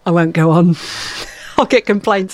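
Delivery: ordinary talk, nothing marked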